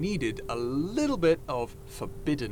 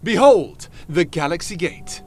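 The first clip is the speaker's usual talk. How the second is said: booming, louder grand announcement; grand proclamation; 3 semitones higher, 9 dB louder than usual. Acted. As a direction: announce it like a showman unveiling a wonder. Unveiling the gate grandly.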